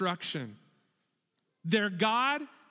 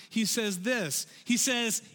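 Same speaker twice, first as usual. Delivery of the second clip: usual speaking voice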